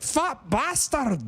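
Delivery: mock Scottish, "Fat bastard" stretched out. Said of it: "it just sounds like".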